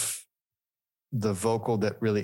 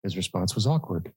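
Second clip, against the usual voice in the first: normal talking voice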